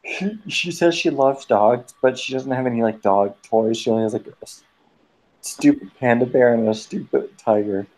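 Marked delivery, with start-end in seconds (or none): none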